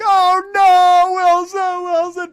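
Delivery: high, anguished mock scream; hammed-up wailing shout, very loud; freaking out. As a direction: bellow the name as a friend drifts away. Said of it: "I'm freaking out".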